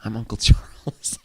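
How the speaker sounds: slow, lightly voiced Uncle Charles; lightly voiced mock uncle; a hint of Uncle Charles. Playing a character voice.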